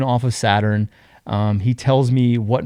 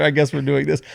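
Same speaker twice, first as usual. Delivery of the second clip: normal talking voice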